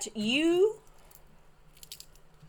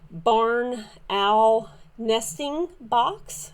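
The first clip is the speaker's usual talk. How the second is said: slow, deliberate word by word; really slow; much slower than usual. Real, not acted. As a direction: stretch out each word carefully. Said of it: "have to say that really slow".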